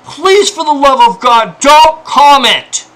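fed-up pleading; exasperated plea. Real, not acted. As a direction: beg through anger. Begging commenters, exasperated.

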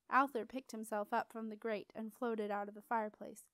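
ordinary delivery

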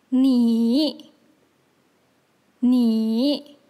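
你 in a low dipping third tone; drawn-out 你, sinking then rising, demonstrated for learners. Shown as a demonstration. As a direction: dip 你 down, then lift it. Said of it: "third tone".

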